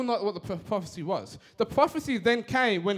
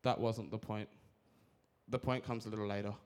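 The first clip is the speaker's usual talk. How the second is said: much softer and lower; quiet aside; 10 semitones lower, 11 dB quieter than usual. Real, not acted. Speaker telling audience the point comes later, quiet aside. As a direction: drop to a low, soft aside. Aside during pause in talk.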